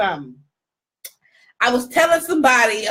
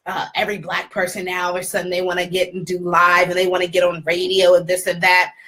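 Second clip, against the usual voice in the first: low, droning put-down in another woman's voice; imitating dismissive acquaintance; 8 semitones lower than usual. Woman belittling the host's live shows, low dismissive imitation. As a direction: mimic her listing complaints, low and unimpressed.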